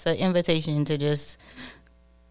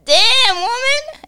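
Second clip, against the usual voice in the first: high, loud mock old-man exclamation; amazed old man; 7 dB louder, much slower than usual. Old man stunned by the mail carrier, hammed-up exclamation. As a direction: squeal it like a smitten old man. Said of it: "He was like".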